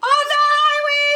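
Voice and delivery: high, loud mock yell; half-joking shout up high. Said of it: "I was like".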